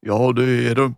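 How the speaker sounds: a child playing a rough-voiced doctor; gruff doctor voice. Emma's doctor confirms on the phone, mock gruff. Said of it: "hun gjorde seg veldig grov i målet".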